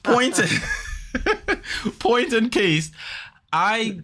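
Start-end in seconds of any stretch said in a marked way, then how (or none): 0.5-1.9 a high, airy burst of real laughter; high breathy laugh. Real, not acted. Real high laugh at his own bedtime story. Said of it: "laughs at own story".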